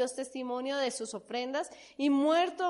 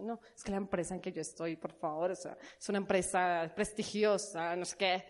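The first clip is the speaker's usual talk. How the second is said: snobby imitation; 7 semitones lower, 4 dB quieter than usual. Imitating proud worldly employee.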